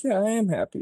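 put-on high, dazed little-kid voice. A kid under the pusher's spell, cheerfully high. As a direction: sound like a hypnotized child.

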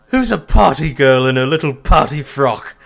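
loud, high, put-on cooing question; imitating old roommate. Old roommate teases, silly loud put-on voice. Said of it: "He'd be like".

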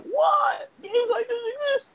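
very high, squeaky incredulous voice; mock disbelief. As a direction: squeal it like you can't believe what you're seeing. Acts out own amazed reaction.

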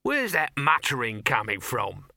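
slow, emphatic demand; irritated boss. Acted. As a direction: demand to know who is muttering. Boss interrupts to find mutterer.

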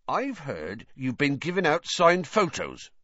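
playful reproach in a gruff character voice; teasing. A narrator's reading. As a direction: accuse him as a tease. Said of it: "he saw that Hagrid was laughing".

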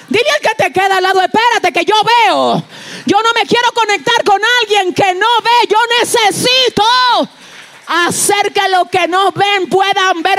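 high, loud urging; rally the people to say it; fervent preaching. Real, not acted. Preacher telling the church to tell their neighbour, loud and high. Congregation told to repeat, loud.

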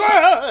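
high, loud startled scream; frightened yelp. Acted. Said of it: "He goes".

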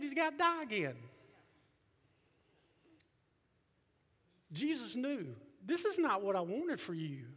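high preaching voice surging into shouts; impassioned preaching. Real, not acted. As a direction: drive each phrase up into a loud cry. Preaching at full force.

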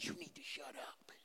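barely audible whispered reprimand; very soft, whispered telling-off; hushed scolding. Retold or imitated. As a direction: whisper it as if shushing someone. Religious people hushing the blind man.

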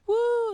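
loud, high party whoop; triumphant whoop. Retold or imitated. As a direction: let out a big drunken "woo". Acting out drunken triumph.

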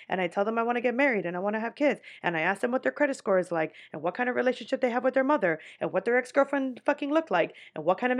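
fast, breathless run-on list; rushed rambling list. Retold or imitated. Mocking herself on a first date, rattling off demands fast. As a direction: tumble through it without pausing.

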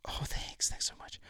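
hushed, imitated dancer's gratitude; soft mock-sweet whisper; whispery thanks.

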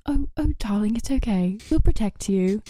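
imitating a kind British couple, soothing, in a British accent. Retelling how a British couple comforted her at the airport, mock British accent. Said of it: "that British couple came up to me".

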